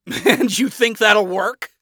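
high, loud, derisive scoff; snorting. A narrator's reading. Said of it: "Adam said with a snort".

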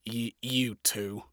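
soft, slow stammering mumble; mumbling. Acted. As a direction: stumble over it shyly. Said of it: "fucking mumbling".

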